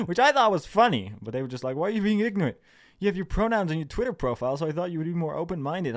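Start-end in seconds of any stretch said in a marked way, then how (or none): 1.8-6.0 high, louder scolding imitation; mock offended commenter. Commenter scolding him for being ignorant, mock offended. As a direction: mimic a reproach in a raised pitch.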